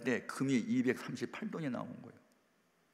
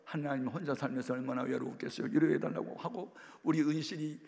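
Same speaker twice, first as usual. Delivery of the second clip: mock tearful prayer; 4 semitones higher, 6 dB louder than usual. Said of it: "막 울면서 나 기도를 했더니".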